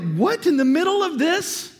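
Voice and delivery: high, drawn-out disbelief; incredulous. Retold or imitated. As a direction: balk in disbelief at the idea. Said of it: "You're like".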